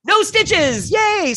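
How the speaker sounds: high, bright mock cheer; relieved cheer. Patient hearing no stitches needed, high mock cheer. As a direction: celebrate skipping the needle, voice leaping high. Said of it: "So I'm like".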